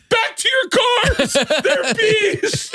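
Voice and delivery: very high, drawn-out warning shout; hammed-up high shriek of alarm; imitated yelling. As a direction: holler a panicked warning to everyone. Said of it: "yelling to everyone".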